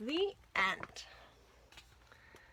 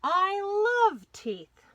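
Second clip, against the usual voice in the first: slow, much higher and louder, relishing every word; delighted dentist; 10 semitones higher, 8 dB louder, much slower than usual. A narrator's reading. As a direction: savour it like a treat. Dentist declares love of teeth.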